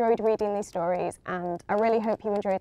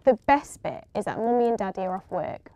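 ordinary delivery, nothing marked

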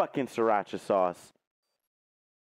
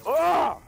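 sharp high cry of pain; pained yelp; 13 semitones higher, 6 dB louder than usual. Real, not acted. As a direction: yelp as the spice burns. Crying out from spicy burn.

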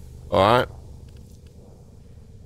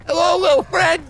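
much higher pet-talk voice to a little animal; cooing to a pet; 4 dB louder than usual. Real, not acted. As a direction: coo it like greeting a small pet. Greeting a pet in the room.

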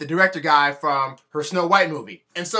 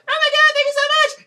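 put-on much higher, giddy girly voice; mock gushing girl; 6 dB louder than usual. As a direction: ham up a starstruck actress. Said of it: "she was like".

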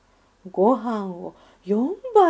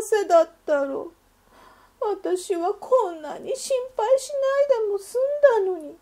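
put-on high, louder, weepy dreaming child; tearful wish; 10 semitones higher, 6 dB louder than usual. A narrator's reading. Soaked in tears, the duckling wishes she sold fee envelopes, weepy and high. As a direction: wish it aloud through tears. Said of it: "ベッドの中で涙でぐっしょりになって".

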